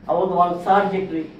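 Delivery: normal talking voice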